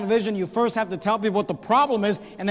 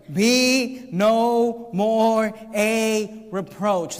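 every word drawn out very slowly; solemn, stretched scripture; slow emphasis; much slower than usual. Shown as a demonstration. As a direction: lay heavy weight on each word. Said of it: "notice the words".

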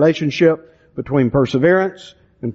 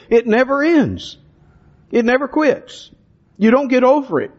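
rising fervor; 7 semitones higher than usual. Real, not acted. Preaching climax on enduring faith.

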